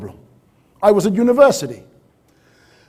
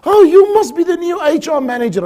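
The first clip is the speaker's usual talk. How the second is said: high, louder, gushing greeting in a put-on secretary voice; cheery secretary; 12 semitones higher, 6 dB louder than usual. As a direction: play the secretary welcoming a newcomer. Voicing Margaret the secretary greeting.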